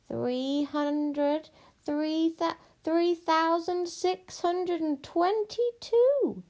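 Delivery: high, drawn-out witch voice; witch counting. Acted. Witch counts pieces of gold, slow and absorbed. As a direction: stretch each number slowly, climbing high.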